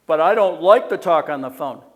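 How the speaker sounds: imitating a reluctant texter; whiny excuse; mock whiny objector. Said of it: "objector voiced".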